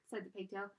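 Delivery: usual speaking voice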